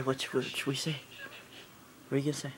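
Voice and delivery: usual speaking voice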